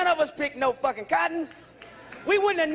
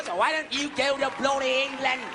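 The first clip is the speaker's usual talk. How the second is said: put-on a touch of a British accent on bloody England; lightly hammed-up English accent; mock British. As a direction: toss it off like a Brit.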